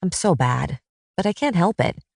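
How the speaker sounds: ordinary delivery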